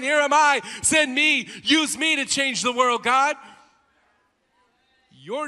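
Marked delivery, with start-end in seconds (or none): 0.0-3.3 loud, higher impassioned plea; fervent cry. Acted. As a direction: cry it out to God at the peak. Congregation's prayer voiced at peak.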